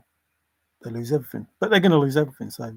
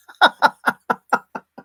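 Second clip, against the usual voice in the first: breathy high laugh burst; burst of laughter; 10 semitones higher, 6 dB louder than usual. Real, not acted. Reacting to the Trump king headline, laughing.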